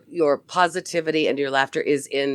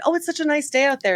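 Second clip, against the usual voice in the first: bright cheerful remark; 9 semitones higher, faster than usual. Retold or imitated. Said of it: "I said".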